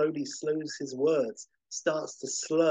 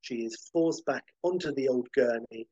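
ordinary delivery

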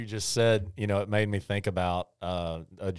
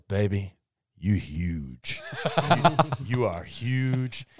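a lightly voiced lovestruck male hippo, slow and laughing through it; mock deep hippo crooning to his girlfriend; slower than usual. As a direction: croon it slowly like a big dopey boyfriend hippo, cracking up. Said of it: "a pretty good impression of that".

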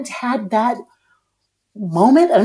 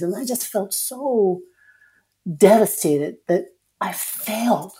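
ordinary talk, nothing marked

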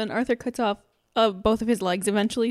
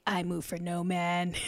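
slow, softer mock-solemn knight; a hint of an immovable guard; lightly voiced stern knight; 2 semitones lower, 6 dB quieter than usual.

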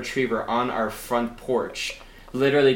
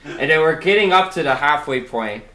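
usual speaking voice

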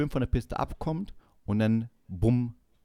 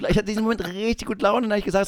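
ordinary delivery

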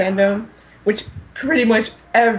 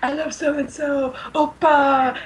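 higher, squealy mock fangirl gush; gushing K-pop fangirl; 5 semitones higher, slower than usual. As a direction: imitate a fan swooning over her idol. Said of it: "the person going, like".

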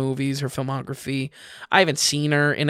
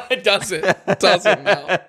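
real laughter through the words; cracking up at a glowing review, higher voice; laughing; 4 semitones higher, slower than usual. Real, not acted.

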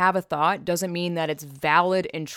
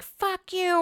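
mock berating; 12 semitones higher than usual. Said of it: "berating and being like".

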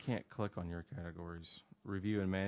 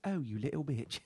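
put-on little boy's voice; 6 semitones higher, 5 dB louder than usual. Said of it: "He goes".

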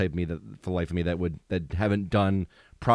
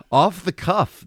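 slow, laughing and louder; amused, drawn-out repeat; 9 semitones higher, 6 dB louder, slower than usual. Real, not acted. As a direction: savor the odd phrase through a laugh. Laughing repeat of odd phrase.

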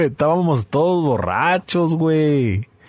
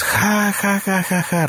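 mock laughter; 8 semitones higher, slower than usual. Imitating girls laughing.

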